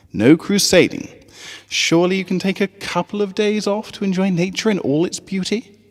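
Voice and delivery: rally the little ponies with a smile; cheerful teacher voice. Acted. Teacher Cheerilee calling her students to camp, bright and high.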